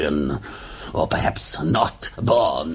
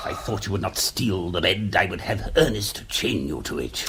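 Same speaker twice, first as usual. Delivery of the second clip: ordinary talk, nothing marked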